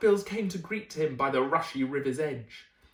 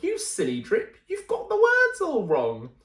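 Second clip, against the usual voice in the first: higher, louder jeering shout with a laugh; mocking yell; 6 semitones higher, 5 dB louder than usual. Sisters jeering at Drip, loud and high. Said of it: "but the others yelled".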